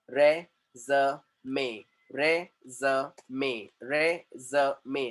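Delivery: रे ज मे spelled out slowly and low; slow syllable-by-syllable teaching. Shown as a demonstration. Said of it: "इसका जो sound है".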